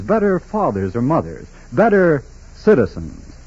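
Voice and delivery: ordinary talk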